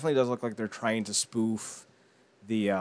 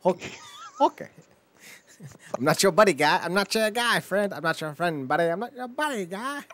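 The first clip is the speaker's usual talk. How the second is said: higher, louder mock Canadian accent; South Park Canadian; 8 semitones higher, 4 dB louder than usual. Quoting South Park's Canadians in a mock accent. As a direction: bounce the buddy-guy lines like South Park. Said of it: "South Park's recreation of that".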